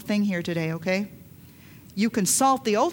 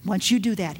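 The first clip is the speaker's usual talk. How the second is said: ordinary talk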